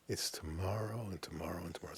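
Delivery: whispered, quick and soft "tomorrow and tomorrow". Shown as a demonstration. Teacher whispering the endless tomorrows to the students. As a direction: breathe the words out as a hushed aside.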